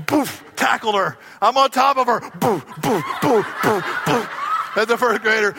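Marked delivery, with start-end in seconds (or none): 2.4-4.3 punching sound effects. Retold or imitated.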